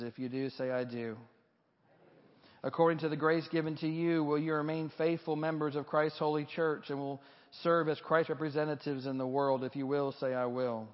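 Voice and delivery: ordinary delivery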